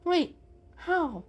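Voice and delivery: puzzled quick question; confused. Acted. Mister Fluffal confused by the plan, puzzled. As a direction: sound baffled. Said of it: "he asked confused".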